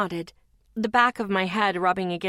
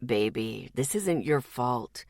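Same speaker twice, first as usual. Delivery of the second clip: low, soft, slow reassurance; gentle; 9 semitones lower, 4 dB quieter, slower than usual. Acted. Zeke comforts the crying Lexi, low, soft and slow. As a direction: comfort her tenderly. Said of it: "His voice was gentle".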